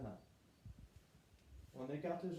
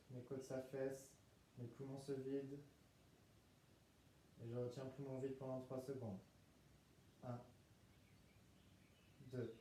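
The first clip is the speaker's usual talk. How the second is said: guided meditation; 2 semitones lower, 7 dB quieter than usual. Acted. Leads breathing in relaxation.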